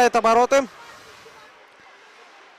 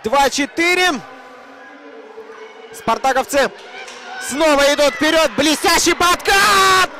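excited commentary; 7 semitones higher than usual. Real, not acted. Hockey commentator, big tackle.

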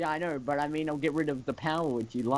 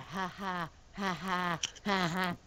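imitated "ha ha" laugh, higher; repeated mock laugh in short pairs; Alyssa's laugh; 4 semitones higher, 5 dB quieter, slower than usual. Player mimicking a disliked laugh, mock laughing. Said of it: "I don't like Alyssa's laugh".

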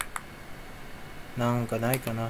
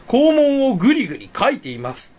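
higher, louder mock announcement; おどけた自己再現; 8 semitones higher, 4 dB louder than usual. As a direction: play up your own silly announcement. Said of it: "ってさ、言ってさ". (おどけて高い声で言い直す)

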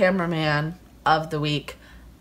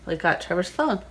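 usual speaking voice

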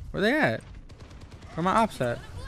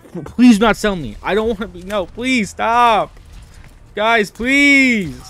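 loud, whiny begging; desperate pleading; 2 semitones higher, 6 dB louder, slower than usual. Real, not acted. Player begging teammates not to throw, desperate pleading. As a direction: plead with drawn-out, falling cries.

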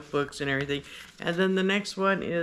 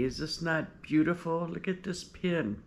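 ordinary talk

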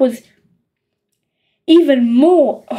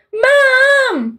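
much higher crying wail; 11 semitones higher than usual. A narrator's reading. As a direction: wail for your mother in tears. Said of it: "wailed Peter".

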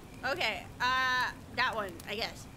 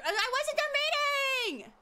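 high, drawn-out protest; indignant surprise; 10 semitones higher, slower than usual. Real, not acted. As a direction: cry out that it skipped. Text skipped too soon.

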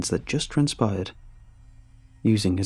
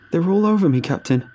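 much higher, louder cry for help; panicked shout; 8 semitones higher, 5 dB louder than usual. A narrator's reading. Ranger swarmed by creatures, shouting to his captain. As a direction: scream it out as they swarm you. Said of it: "Adrian shouted".

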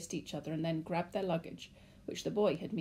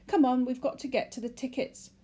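put-on high, loud boy's voice; impatient boy; 6 semitones higher, 6 dB louder than usual. A narrator's reading. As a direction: urge them on like a kid. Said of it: "the boy said".

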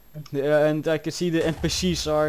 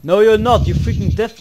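loud, high retort; annoyed shout; 6 semitones higher, 8 dB louder than usual. Real, not acted. Player contradicting the other player, annoyed shout. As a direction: snap back loudly at the other player.

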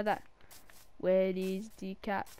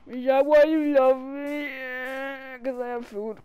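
mock hurt; 4 semitones higher, 11 dB louder, slower than usual. Mock hurt whine at cat.

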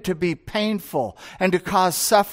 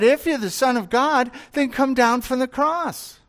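higher, jeering taunt; mocking taunt; 7 semitones higher than usual. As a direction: sneer it up at the man on the cross. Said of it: "And so they mocked him".

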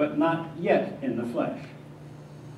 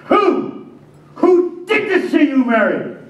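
loud, high demand; raging question; 12 semitones higher, 6 dB louder, slower than usual. Acted. Joseph demanding to know who did it, raging. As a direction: hurl the question at her. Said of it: "the question that was raging in my mind".

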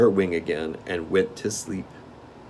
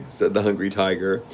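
usual speaking voice

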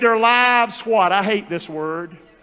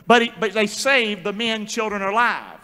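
ordinary talk, nothing marked

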